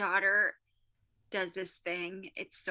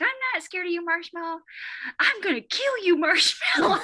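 put-on high little-kid voice building louder; daughter's threat; 9 semitones higher, 4 dB louder than usual. Daughter to pretend villain Marshmallow, high mock menace. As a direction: imitate a small child's escalating play threat. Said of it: "she took it a step further".

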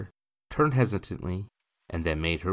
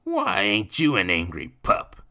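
put-on loud, raised drunkard's jeer; mocking, louder and higher; leering taunt; 5 semitones higher, 7 dB louder than usual. A narrator's reading. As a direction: taunt a child with a leer. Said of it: "he leered down at Jacques".

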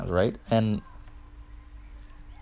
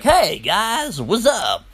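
much higher, exaggerated greeting; higher mock impression of the annoying guy; 10 semitones higher, much slower than usual. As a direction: ham up the guy's obnoxious hello.

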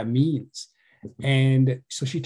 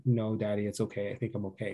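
quiet reassurance; 4 semitones lower, 10 dB quieter than usual. Retold or imitated. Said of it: "she said".

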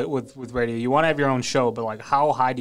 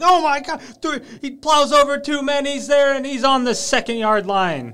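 high, loud, hyped radio call; Jim Donovan play call; 13 semitones higher, 7 dB louder than usual. Retold or imitated. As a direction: call a tiny run like a huge play. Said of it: "Jim Donovan can make, like, a three-yard run sound like".

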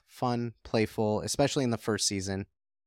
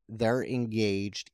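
usual speaking voice

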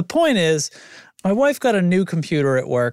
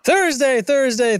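high excited call; 10 semitones higher, slower than usual. Pretend. Dramatic repeated day announcement.

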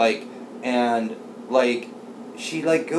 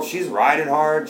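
usual speaking voice

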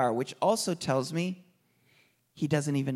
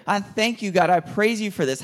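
loud praise prayer; 5 semitones higher, 5 dB louder than usual. Acted.

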